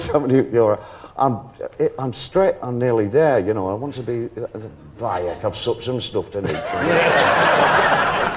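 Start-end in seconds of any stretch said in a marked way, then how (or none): 5.0-8.3 put-on light Yorkshire accent. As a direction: slip into a northern Yorkshire voice. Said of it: "Yorkshire accent".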